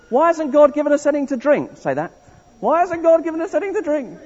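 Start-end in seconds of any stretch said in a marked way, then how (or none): 0.1-1.8 high-pitched grumble, like a moaning Israelite; grumbling complaint. Shown as a demonstration. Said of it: "started to grumble". 2.6-4.2 whining. Shown as a demonstration. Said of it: "do some whining".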